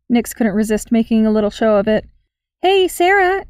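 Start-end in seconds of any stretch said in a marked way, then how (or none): 2.6-3.4 casual call. Acted. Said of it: "she called in a casual tone".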